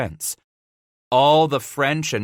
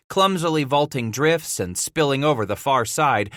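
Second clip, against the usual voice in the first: usual speaking voice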